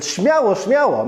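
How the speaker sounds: high, urging "Śmiało, śmiało"; retold urging. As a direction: lift it up to hurry him on. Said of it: "and he said".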